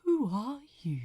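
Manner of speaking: breathless whisper full of wonder; awed whisper. A narrator's reading. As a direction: ask it hushed, astonished. Tear-streaked man asks in wonder.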